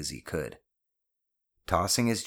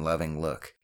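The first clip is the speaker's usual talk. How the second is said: usual speaking voice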